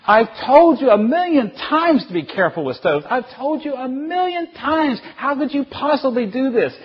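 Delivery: high, loud, outraged scolding; acted frantic telling-off, words stretched out; screaming parent. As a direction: scream at a child after a scare. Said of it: "you're screaming at her".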